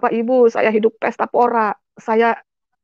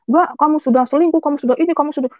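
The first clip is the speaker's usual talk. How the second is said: high, heated accusation; accusing husband; 5 semitones higher than usual. Retold or imitated. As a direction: hurl the accusation at her. Husband accusing her of cheating.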